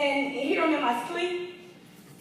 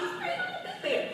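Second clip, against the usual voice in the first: squeal; 7 dB quieter than usual. Acted. High squeal in sermon.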